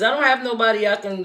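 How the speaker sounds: ordinary talk, nothing marked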